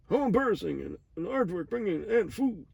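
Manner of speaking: squeaky-high imitation of a cartoon line; cartoon character.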